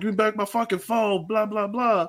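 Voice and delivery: retold angry accusation. Customer accusing a family over his phone, mock angry. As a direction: accuse them, hammed up a bit. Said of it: "being like".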